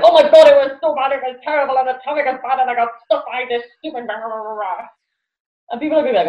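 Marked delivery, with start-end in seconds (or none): 0.0-4.9 whiny complaining. Retold or imitated. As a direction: play the commuter venting about traffic, mock grumpy. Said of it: "you're like".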